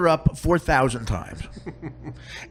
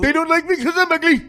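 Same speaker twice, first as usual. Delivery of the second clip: high, hammed-up pitiful whine; mock wailing woman; 8 semitones higher than usual. Shunned mother bemoaning her ugliness, mock wailing. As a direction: plead it in a high put-on voice.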